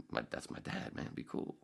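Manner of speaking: whispered, soft mock secret; hushed aside. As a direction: drop to a whisper like sharing a secret.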